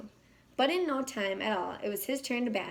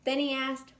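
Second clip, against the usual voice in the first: plain reading voice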